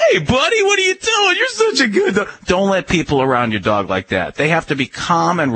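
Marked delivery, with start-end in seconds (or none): 0.1-2.3 squealing mock excitement at a dog; excited dog greeting. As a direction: gush over the dog like an overexcited visitor. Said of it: "who goes".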